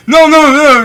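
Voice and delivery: high, loud panicked cry. Acted. Fleeing customer cries out to the seller in high loud panic. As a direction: yelp it out as you bolt away.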